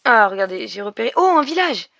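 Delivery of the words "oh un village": a bright, higher "oh" of discovery; excited discovery call; surprised. Real, not acted. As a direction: gasp out the find, pitched up and louder. Spotting a village in game.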